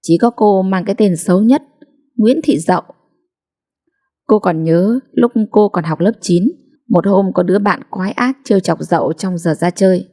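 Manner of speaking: ordinary delivery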